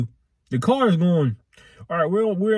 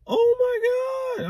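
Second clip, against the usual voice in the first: high startled "oh my God"; shocked gasp; faster than usual. Retold or imitated. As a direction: gasp it out in alarm. Mom reacting to swerving car.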